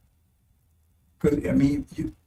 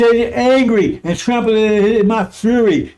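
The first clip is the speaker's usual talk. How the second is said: slow, raised angry emphasis; furious; 4 semitones higher, 4 dB louder, slower than usual. Acted. Preacher showing listeners the Messiah's wrath, furious. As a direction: drive each word out high, slow and angry. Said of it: "He's furious".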